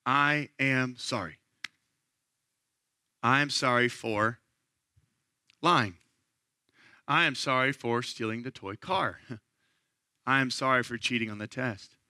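slow, low, humble apology; plain honest confession spoken slowly; sincere confession. Shown as a demonstration. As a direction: say each sorry like you mean it. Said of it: "my practical outworking of confession".

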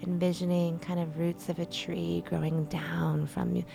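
soft, calm meditation voice with whispered lines; guided meditation. Acted. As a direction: guide them gently into stillness.

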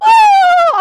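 very high, loud "woo"; startled whoop. Retold or imitated. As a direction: burst out like someone tickled by surprise. Imitates ticklish person jumping.

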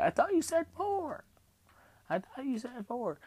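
tiny, very high squeak; little voice. Retold or imitated. Patient retelling the protest over extra staples, in a little squeaky voice. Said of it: "in my little voice".

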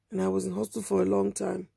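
usual speaking voice

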